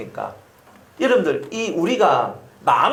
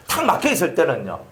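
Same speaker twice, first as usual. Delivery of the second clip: normal talking voice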